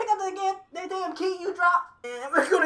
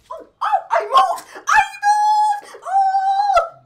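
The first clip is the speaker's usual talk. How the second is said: high, loud, singsong cheer; excited shout; 13 semitones higher, 10 dB louder, much slower than usual. Real, not acted. Thrilled he moved in VR.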